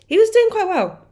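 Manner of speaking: high, loud burst of enthusiasm; excited. Real, not acted. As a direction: blurt it out excitedly. Excited aside.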